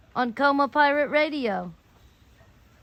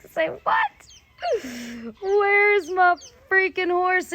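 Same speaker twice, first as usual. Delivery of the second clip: shocked disbelief; 6 semitones higher, much slower than usual. Retold or imitated. Shocked reply in retold exchange.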